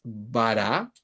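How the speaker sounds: "Bara" pronunciado con la r suave; Bara en hebreo. Shown as a demonstration. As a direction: leer "Bara" claro, con a abiertas. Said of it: "acá dice después". ("Bara" leído del texto hebreo)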